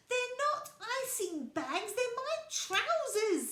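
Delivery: high, loud, upset protest; near tears. A narrator's reading. Little Bear tells Bramwell his trousers aren't icing bags, near tears. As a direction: hold back tears while protesting. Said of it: "trying hard not to cry".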